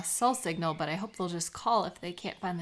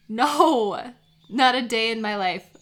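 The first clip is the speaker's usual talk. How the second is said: louder, laughing protest that she's a total beginner; emphatic denial; 7 dB louder than usual. Real, not acted. Friend insisting to friend she's never birdwatched, emphatic. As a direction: throw up your hands, never ever.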